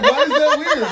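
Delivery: high, loud, laughing question; indignant question. Retold or imitated. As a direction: squeal it in disbelief. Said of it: "I was like".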